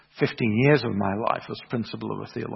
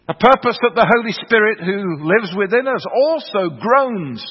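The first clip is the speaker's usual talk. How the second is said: higher, louder preaching voice building on "purpose"; 6 semitones higher, 4 dB louder than usual. Acted. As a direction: lift the congregation with each repeated "purpose".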